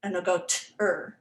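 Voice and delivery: imitating a student saying "ter" with the vowel dropped; student misreading ter. Teacher imitating a student's misreading of ter. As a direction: sound out "ter" the way a struggling student would. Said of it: "my students will just go like this".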